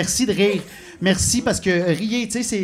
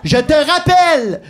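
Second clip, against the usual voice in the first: slow, high, loud pretend call ending; faked phone sign-off; 9 semitones higher, 8 dB louder, slower than usual. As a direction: announce it loudly, stretched out for show. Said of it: "d'éteindre une discussion que j'avais sur mon Bluetooth".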